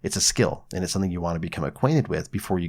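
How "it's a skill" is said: ordinary talk, nothing marked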